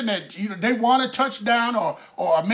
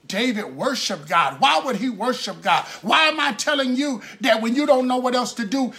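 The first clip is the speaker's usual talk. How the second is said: ordinary talk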